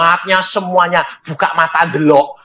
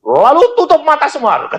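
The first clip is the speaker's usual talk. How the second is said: high, loud stern command; angry pastor; 13 semitones higher, 3 dB louder than usual. Retold or imitated. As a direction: order the congregation to pray. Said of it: "sepedeta marah".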